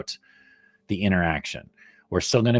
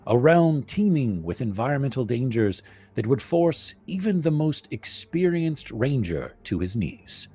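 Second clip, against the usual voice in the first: story narration; 4 semitones higher than usual. Acted. Reads campaign blurb aloud.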